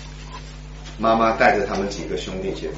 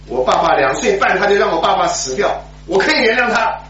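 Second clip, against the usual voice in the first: bitter outburst; 10 semitones higher than usual. Retold or imitated. Grieving boy raging at God to his siblings. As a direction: spit it out through tears.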